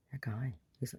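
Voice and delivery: hushed and breathless, voice dropping away; whispered dread. Real, not acted. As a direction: whisper it as if he might still hear. Dread recalling the man.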